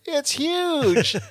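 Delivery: very high mock wonder; imitated astonishment. Friends step inside the maze, high-pitched mock astonishment. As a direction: squeal it like an amazed explorer. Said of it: "and it's like".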